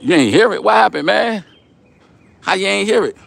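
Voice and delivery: high-pitched disbelieving questions; how-could-you-miss-that incredulity; incredulous. Real, not acted. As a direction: challenge them in disbelief, pitch jumping up. Teasing a viewer who didn't hear.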